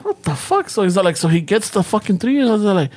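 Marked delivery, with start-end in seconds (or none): 0.0-0.7 high, stunned what the fuck; disbelieving outburst. Retold or imitated. Hearing the baby needs three pupusas, stunned disbelief. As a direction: pitch shoots up in disbelief. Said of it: "And I'm like".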